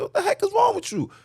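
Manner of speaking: high, fast, exasperated mock yelling; retold outburst. Snapping at the police officer over the homeless man, high and fast. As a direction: spaz at the cop all over again. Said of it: "I had to spaz on the police officer".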